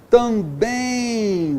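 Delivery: também vogal drawn out slowly; patient teacher spelling out the sounds; slow demo. Shown as a demonstration. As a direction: stretch it to show the nasal glide.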